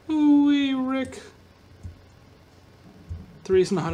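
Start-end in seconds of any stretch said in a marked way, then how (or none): 0.1-1.2 high, loud squeaky catchphrase; Mr. Poopybutthole catchphrase. Retold or imitated. Gamer quoting a cartoon in a squeaky voice. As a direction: chirp it like the cartoon character.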